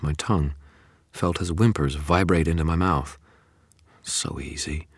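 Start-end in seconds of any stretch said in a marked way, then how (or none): 4.0-4.9 breathy tempting whisper. A narrator's reading. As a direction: tempt yourself quietly. Seductive inner temptation.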